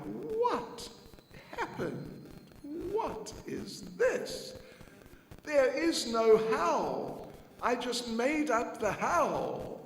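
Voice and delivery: high, loud, slow amazement; a mother who can't believe her eyes; Yashoda's startled high cry of disbelief; bewildered mother. Acted. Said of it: "she was speaking like this".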